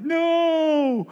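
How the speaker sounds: high, long drawn-out mock wail; imitated toddler wail. Father imitating son as he leaves, high mock wail. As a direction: wail it like a child in tears. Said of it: "it's just like waterworks".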